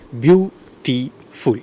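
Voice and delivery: "beautiful" drawn out slowly in three separate syllables. Shown as a demonstration. Teacher asking how many syllables, "beautiful" split slowly. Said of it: "how many syllables".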